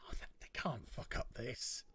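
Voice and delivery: a half-whispered inner voice. Retold or imitated. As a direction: murmur it to yourself in disbelief. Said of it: "I was in my head".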